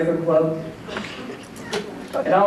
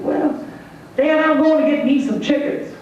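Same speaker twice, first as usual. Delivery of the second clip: put-on high little-boy voice; pitched up, a kid announcing his plan; 7 semitones higher than usual. Son tells his dad about chickens, high kid voice. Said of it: "he's got a son named J R".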